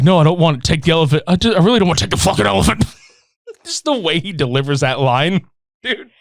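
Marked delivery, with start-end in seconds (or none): 0.0-2.8 fast, flustered refusal; rushed refusal. Retold or imitated. Julie refuses the stuffed elephant, fast and flustered. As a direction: blurt the refusal out quickly. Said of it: "And she's like".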